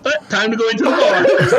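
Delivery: mock-casual quip with a laugh in it; lightly voiced buddy shrugging it off; laughing, half-hearted imitation; casual brush-off. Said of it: "hot chocolate's like".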